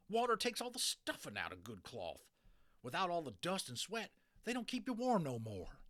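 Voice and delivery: high, loud cowboy voice; digging his heels in, cowboy; stubborn cowboy. Acted. Boone refuses laundry.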